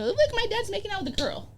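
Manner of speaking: high-pitched imitation of a little kid; small, high put-on kiddie voice; mock child tattling. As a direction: imitate a child telling on dad. Voices the child supposedly telling on dad.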